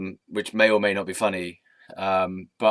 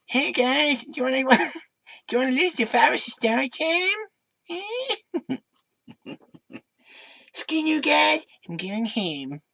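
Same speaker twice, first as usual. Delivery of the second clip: very high squeaky cartoon kid voice; 14 semitones higher than usual. Greeting viewers then storming off, in a high cartoon kid voice. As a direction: squeak it like a bratty cartoon boy. Mock Cartman-style kid voice quote.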